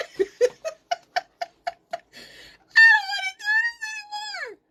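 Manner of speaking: extremely high, squealed wail; hammed-up sob pitched to a squeak; mock crying. Laboring mom refusing to go on, squeaky mock crying. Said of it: "I was crying".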